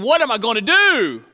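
loud, hammed-up hand-wringing; mock despairing cry; mock helpless worry. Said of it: "wringing his hands saying".

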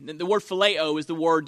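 ordinary delivery

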